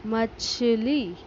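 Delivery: मछली said very slowly, each syllable clear; teacher modelling मछली slowly; slowly modelled word. Shown as a demonstration. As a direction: drag out मछली for the class. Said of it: "तेज खींचकर पढ़ते हैं".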